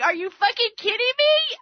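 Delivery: half-acted disbelief; incredulous. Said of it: "I'm like".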